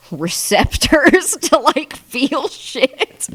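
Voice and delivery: high, very slow laughing words; dragging it out through giggles. Real, not acted. Telling viewers cows can feel, laughing high and slow. Laughing at her own point.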